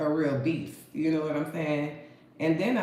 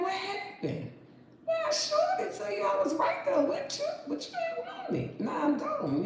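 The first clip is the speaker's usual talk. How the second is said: much higher, sing-song told-you-so voice; high mocking told-you-so. To people who ignored her advice, a high mocking told-you-so. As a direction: taunt them like you warned them all along.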